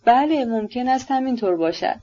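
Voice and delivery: ordinary delivery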